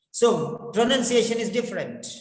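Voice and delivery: usual speaking voice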